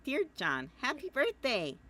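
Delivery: a hint of a cheery girl; Jade voice. Reading Jade's birthday letter to John, lightly voiced Jade. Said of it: "I keep doing Jade voice now".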